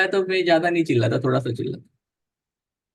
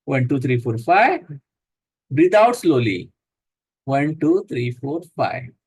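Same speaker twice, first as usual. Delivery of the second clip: slow, lower counting for each breath; guided breathing count; 6 semitones lower than usual. Acted. Yoga teacher counting breaths for the class, calm and steady. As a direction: count the breath out gently, unhurried.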